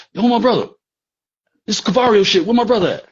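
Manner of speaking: high, loud demand; yelling. Retold or imitated. Nelson shouting at V, demanding his brother, loud. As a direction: shout it up at the car.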